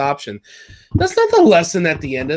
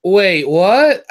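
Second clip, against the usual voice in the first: loud, higher rising double-take; mock bewilderment; 4 semitones higher, 8 dB louder than usual. Podcaster retelling their reaction to the ending, loud mock bewilderment. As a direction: blurt it as a baffled question. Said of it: "And then I'm like".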